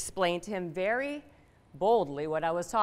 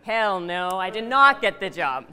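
loud punchline; 3 semitones higher, 10 dB louder than usual. Real, not acted. Building punchline to audience.

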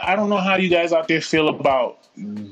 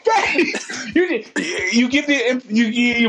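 laughing; 7 semitones higher, much slower than usual. Real, not acted. Laughing at his own story.

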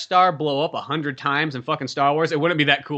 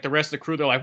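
ordinary talk

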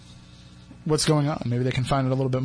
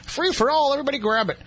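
excited; 11 semitones higher than usual. Pretend. Joking about money dumping out.

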